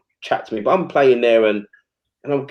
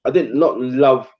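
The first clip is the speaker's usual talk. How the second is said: usual speaking voice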